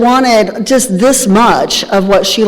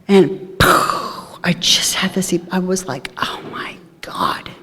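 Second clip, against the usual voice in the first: awed whisper; 8 dB quieter, slower than usual. Real, not acted. Sudden inner realization, told hushed.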